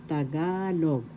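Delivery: GA stressed and drawn out in "Tagalog"; stress on second syllable. Shown as a demonstration. Teacher demonstrating the accent, GA stressed in "Tagalog". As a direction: hold the GA in "Tagalog". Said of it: "accent is in the second syllable".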